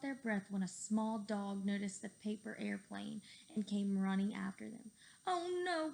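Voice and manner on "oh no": high, loud scared cry; cried out. A narrator's reading. Cheeseball seeing the chasing dog, high loud scared cry. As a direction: cry out in alarm. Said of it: "cried Cheeseball".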